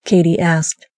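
ordinary delivery, nothing marked

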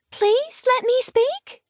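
put-on very high, slow little-girl plea; drawn-out high piping request; child calling out. A narrator's reading. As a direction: call up to the driver as a small child. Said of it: "she called".

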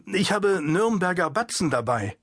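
ordinary delivery

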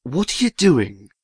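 low, sighing question; weary sigh. A narrator's reading. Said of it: "asked Paul with a sigh".

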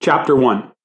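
ordinary delivery, nothing marked

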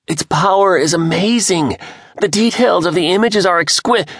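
high, loud, overexcited; raving until he is interrupted; gushing. A narrator's reading. James gushing before being cut off.

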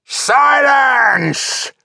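intimidating roar, high and drawn out; furious bellow. Acted. Chieftain silencing a dissenting trooper, intimidating bellow. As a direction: bellow it at full force to cow the troops. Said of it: "he bellowed, throwing back his head".

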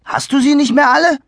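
put-on kid's incredulous retort; indignant boy. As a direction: snap at the intruder. Adi snaps at the boy.

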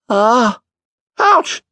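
hammed-up high ouch; squeaky complaining cries; mock pain yelps. A narrator's reading. Bingo limping to the door, hammed-up yelps. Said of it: "uttering all his usual expressions of pain".

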